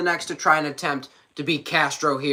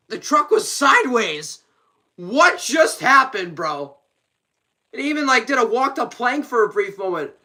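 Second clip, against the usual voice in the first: normal talking voice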